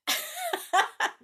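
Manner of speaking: high burst of real laughter; hearty laugh. Real, not acted. Storyteller amused by the entity's encounter, laughing heartily. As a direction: break into a high-pitched laugh.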